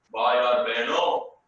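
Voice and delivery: slow orator's greeting in Hindi; Modi's rally opening. Retold or imitated. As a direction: call out slowly to the crowd, then hold. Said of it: "Then he'll pause".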